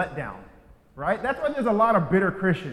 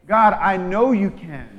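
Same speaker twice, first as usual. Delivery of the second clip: slow, firm certainty; confident prayer; 2 semitones higher, 6 dB louder, slower than usual. Shown as a demonstration. Preacher modelling prayer, slow and firm. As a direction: lean into know. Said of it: "Our prayers need to change".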